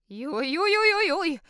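very high, loud squeals; the old aunt squealing at the sight of a bear; frightened cry. A narrator's reading. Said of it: "kiáltott fel Rudolfina".

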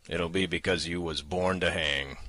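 plain reading voice

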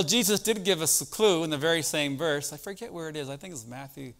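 ordinary talk

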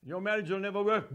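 ordinary delivery

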